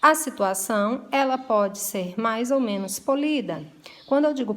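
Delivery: ordinary talk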